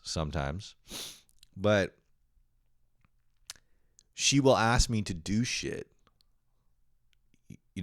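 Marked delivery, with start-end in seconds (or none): none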